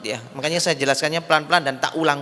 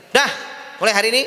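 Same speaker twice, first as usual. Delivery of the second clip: stern, higher-pitched dismissal; husband throwing wife out; 6 semitones higher, faster than usual. Acted. Husband sending wife to her parents, stern. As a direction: order her out firmly, voice lifted. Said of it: "istrinya diusir".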